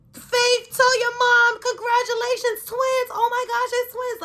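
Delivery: imitating an overjoyed classroom, very high and loud; high-pitched mock crowd squeal; happy cheering. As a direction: shriek congratulations like excited classmates. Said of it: "they were so happy".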